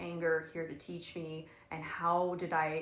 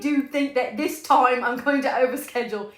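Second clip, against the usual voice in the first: mock hopeful self; 5 semitones higher, 9 dB louder than usual. Mocking self-talk quote.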